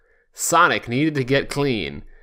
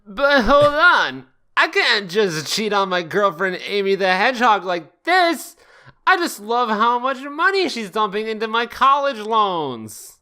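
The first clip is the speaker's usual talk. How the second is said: higher, cartoon hero voice; high Sonic voice; 7 semitones higher than usual. Sonic fretting about cheating on Amy, high voiced. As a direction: chirp it like a cartoon hedgehog.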